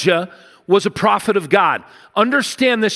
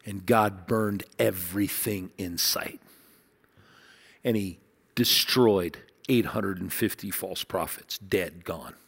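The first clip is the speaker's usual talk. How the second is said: low, much softer, awed; awed hush; 10 semitones lower, 9 dB quieter than usual. Real, not acted. Preacher tells of God's fire, hushed in awe. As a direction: let the awe pull it down to a hush. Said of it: "I get chills saying it".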